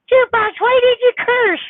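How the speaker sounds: high-pitched mimicking of a complainer; mock scolding viewer. Streamer parrots a viewer questioning the cursing, mock scolding. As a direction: put on a high voice to parrot a critic.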